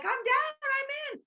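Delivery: squealed high and bright, all in; an instant, giddy sign-up; eager yes. Retold or imitated. Coach voicing a thrilled blue recruit, high and excited. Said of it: "they're like".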